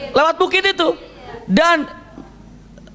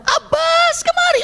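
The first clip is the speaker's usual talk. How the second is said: urgent call; slower than usual. Retold or imitated. Prophet calls Abbas over, very high urgent call. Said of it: "Sayyidina Abbas begitu ada".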